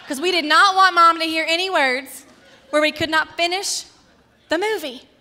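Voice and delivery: high, very loud insistence; emphatic outburst. Real, not acted. Sisters muting the movie so Mom won't hear, emphatic outburst. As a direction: belt it out as urgent comic emphasis. Comic emphasis in childhood story.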